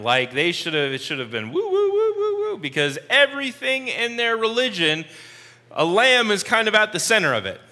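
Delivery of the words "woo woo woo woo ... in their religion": high-pitched wooing siren; warning siren. Retold or imitated. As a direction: imitate a wailing alarm siren. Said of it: "a warning siren".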